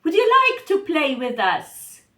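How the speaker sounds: put-on quick eager child voice; playful invitation. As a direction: coax dad into playing. Eva invites her father.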